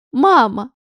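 loud sharp cry to interrupt; cutting shout. Acted. Daughter stops mother's flood of words, sharp loud cry. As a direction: cut her off with one loud call. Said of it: "остановила этот поток слов дочь".

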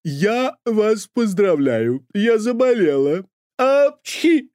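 put-on high, slow theatrical old nanny; high, slow proud invalid nanny; dramatic sick nanny. A narrator's reading. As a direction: announce illness like a triumph. Nanny announces illness.

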